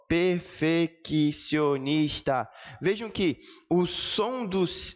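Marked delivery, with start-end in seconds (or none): 0.1-2.5 very slow, syllable by syllable; silabação de professora. Shown as a demonstration. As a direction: sound out perfeccionista with every syllable apart. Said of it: "do jeitinho como a tia Doris Cleide ensinou". (silabando bem devagar, como na escola)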